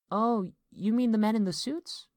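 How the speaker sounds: put-on high, loud child voice; smiling little girl. A narrator's reading. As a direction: ask brightly with an audible smile. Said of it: "Lisa said this with a smile".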